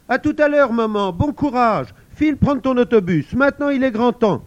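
high, loud, cheering; encouraging child. Acted. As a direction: send mum off bravely. Child sends mum off. (Pauline encourage sa maman)

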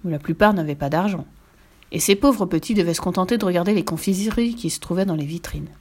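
usual speaking voice

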